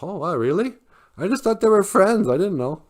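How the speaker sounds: high-pitched wide-eyed little bear; surprised. A narrator's reading. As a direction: react with innocent amazement. Cub surprised by news.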